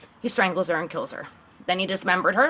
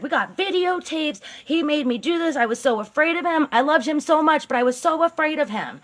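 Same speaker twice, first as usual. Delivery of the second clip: higher, whiny mock-pitiful voice; mock victim; 6 semitones higher than usual. Carla testifying to police, hammed-up mock pitiful voice. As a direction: play the poor frightened wife, hammed-up. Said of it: "She's like".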